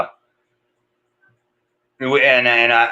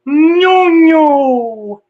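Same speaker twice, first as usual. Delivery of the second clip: much higher, louder "No, no"; little kid refusing; dad voicing his toddler son; imitating toddler; 6 dB louder than usual. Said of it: "So he goes".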